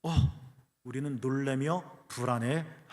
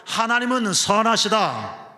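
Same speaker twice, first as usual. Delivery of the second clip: loud, high congregational lead; rousing call-out; 6 semitones higher, 8 dB louder than usual. Shown as a demonstration. Preacher leads congregation in repeating the line, rousing loud call. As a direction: proclaim it loudly for everyone to repeat. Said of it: "따라해볼까요?".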